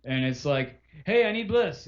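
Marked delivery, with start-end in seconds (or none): 1.1-1.9 abrupt imitated caller. Acted caller ignoring the greeting.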